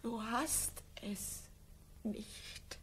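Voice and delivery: put-on breaking down over childlessness, faint; broken murmur. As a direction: barely get the words out.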